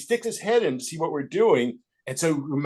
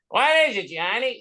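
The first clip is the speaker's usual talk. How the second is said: Bob Hope impression; 7 semitones higher than usual. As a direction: bark it back as Bob Hope. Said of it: "me doing Bob Hope".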